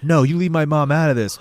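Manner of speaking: acted angry refusal. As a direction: act out warning someone off. Boy arguing with possessed record.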